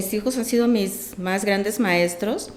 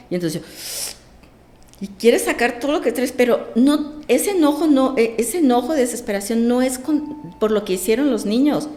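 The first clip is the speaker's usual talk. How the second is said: ordinary delivery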